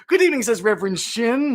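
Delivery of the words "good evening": high voice. Retold or imitated. Quotes viewer's greeting, much higher.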